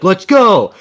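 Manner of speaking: loud, high hype yell; hype shout. Retold or imitated. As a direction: pump the team up. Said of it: "He's like".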